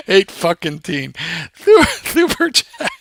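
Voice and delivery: slow words breaking into high laughter; laughing. Real, not acted. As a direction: try to speak through a laughing fit. Still cracking up after story.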